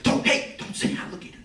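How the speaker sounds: urgent hushed whisper. Retold or imitated. Manager hushing him about the alligator, urgent whisper. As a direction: hush him before anyone hears.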